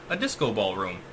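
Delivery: ordinary delivery, nothing marked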